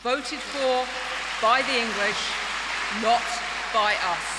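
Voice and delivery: loud, rousing rally cry; rousing shout. Real, not acted. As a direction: belt it to the back of the hall. Rallying conference crowd.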